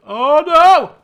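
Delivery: sudden shout of alarm; loud startled cry. Real, not acted. Gamer startled by bandits, shouting loudly. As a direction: yelp out in real alarm. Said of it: "That was very loud, sorry".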